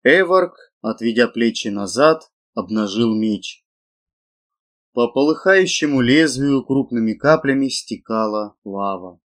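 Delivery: ordinary delivery, nothing marked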